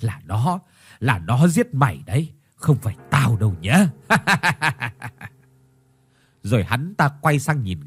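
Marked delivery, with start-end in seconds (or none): 0.0-3.9 gloating villain. Acted. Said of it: "nụ cười khoái chí". 3.9-5.3 evil laugh. Acted. Villain gloats.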